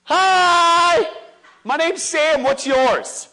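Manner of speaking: put-on high, loud child's greeting; eager little girl. Dad voices his little daughter meeting a kid at the swings, high and loud. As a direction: beam at a stranger like a five-year-old. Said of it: "she'd go".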